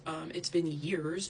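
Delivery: normal talking voice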